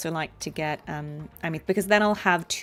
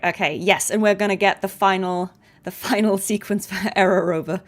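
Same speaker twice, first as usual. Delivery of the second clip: ordinary talk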